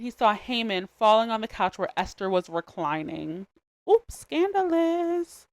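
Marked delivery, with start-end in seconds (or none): 3.9-5.5 high, slow, drawn-out gasp of "scandalous"; stretched, high mock-shocked "Oops, scandalous"; Ashley-style scandalous. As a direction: play the gossipy cartoon girl catching a scandal. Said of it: "That's exactly what popped in my head".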